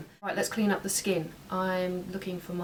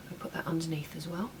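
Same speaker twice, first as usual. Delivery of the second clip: whispering; 2 semitones lower, 7 dB quieter than usual. Real, not acted. Whispered aside while applying.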